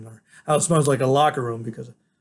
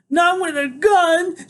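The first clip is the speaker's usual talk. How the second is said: screaming through tears, very high and loud; grandma shrieking in tears, slow; tearful yelling; 7 dB louder, much slower than usual. Acted. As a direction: scream it at him, crying. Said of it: "she yells".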